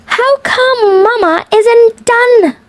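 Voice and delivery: ordinary delivery, nothing marked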